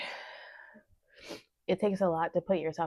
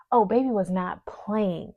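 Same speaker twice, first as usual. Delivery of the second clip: ordinary talk, nothing marked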